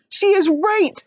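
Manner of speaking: high loud shout. Acted. Ladybug agreeing with Miss Spider, high shout. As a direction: shout it in alarm. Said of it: "shouted the ladybug".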